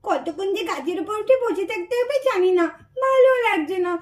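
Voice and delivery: soft, hidden muttering; hushed to herself. Acted. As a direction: keep it low so nobody hears. Hiding child talks to herself. (লুকিয়ে ফিসফিস করে নিজের মনে বলা)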